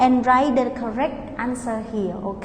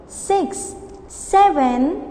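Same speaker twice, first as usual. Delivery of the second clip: ordinary delivery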